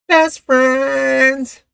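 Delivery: high falsetto sing-song; mock high catchphrase voice; put-on catchphrase. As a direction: squeal it like a catchphrase.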